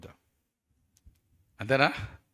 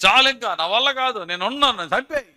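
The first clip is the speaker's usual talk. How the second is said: high, loud, furious outburst; furious Elijah; 11 semitones higher, 11 dB louder than usual. Acted. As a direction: yell at God to finish it. Elijah fuming and demanding death. (కోపంతో గట్టిగా అరుస్తున్న ఏలియా)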